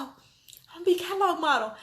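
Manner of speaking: high, bright announcement; thrilled announcement. Retold or imitated. Telling her daughter she's a catalog model, thrilled. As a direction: burst out the big news. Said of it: "I go".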